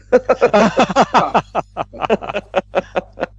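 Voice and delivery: a long, high laugh that dies away. Real, not acted. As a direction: laugh long at the bad luck, then fade. Laughs at the failed roll.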